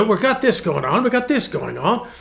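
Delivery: imitating British accent. Pastor imitating the British renovator's pep talk in a British accent. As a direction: do the cheery British builder. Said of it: "so he tells you what he's gonna do".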